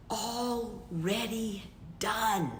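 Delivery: slow, savoured and drawn out; drawn-out affirmation. Shown as a demonstration. Coach lets listeners savour the feeling, slow and drawn out. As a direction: let the words sink in slowly.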